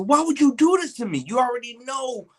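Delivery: high, loud whiny protest; retold high-pitched complaint; mock outrage. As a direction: wail it like a betrayed friend. Betrayed by edible.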